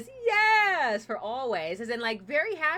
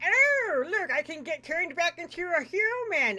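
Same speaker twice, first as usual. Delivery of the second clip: very high, loud eager voice; light up with a hopeful idea; Tiny spotting the prince; excited Tiny; 8 semitones higher, 5 dB louder than usual. Acted. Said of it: "and says".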